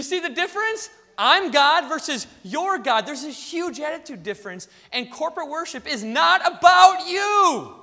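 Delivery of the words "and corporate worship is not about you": loud, high preacher's shout; forceful preaching. Real, not acted. Preacher correcting congregation about corporate worship, forceful shout. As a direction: raise the voice and drive it home. Preacher drives home his point.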